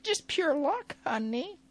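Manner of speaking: very high, sugary mock diva drawl. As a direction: coo it like a smug film star. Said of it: "She's like".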